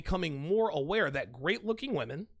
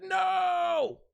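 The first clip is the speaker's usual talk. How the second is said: long, high-pitched wailed no; mock anguished cry; 14 semitones higher, 4 dB louder than usual. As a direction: cry it out in hammed-up despair, drawn out. Acting out his alarmed reaction.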